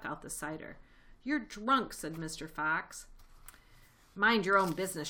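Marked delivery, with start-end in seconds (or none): 1.2-1.9 raised, higher accusation; accusing. A narrator's reading. Fox accuses the rat. 4.1-5.1 loud, irritated retort; shrieked. A narrator's reading. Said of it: "shrieked Rat".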